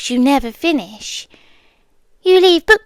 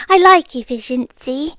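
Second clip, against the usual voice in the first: ordinary delivery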